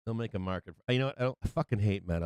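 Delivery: ordinary talk, nothing marked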